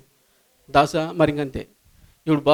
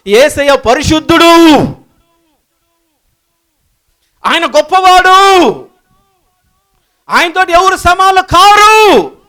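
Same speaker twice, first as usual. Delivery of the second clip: loud, high cries of praise, drawn out; ringing worship shout; full-force preaching; 6 semitones higher, 10 dB louder than usual. Acted. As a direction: shout each acclamation and hold the last word.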